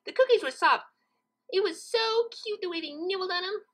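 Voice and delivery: high, bubbly pony chatter; Pinkie voice. Acted. Pinkie explains to Twilight where two cookies went. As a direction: gush about the cute pets.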